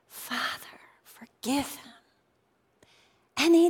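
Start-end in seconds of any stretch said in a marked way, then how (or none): 0.1-1.9 hushed, breathy whisper of a dying man's prayer. Retold or imitated. Jesus on the cross prays for his mockers, whispered.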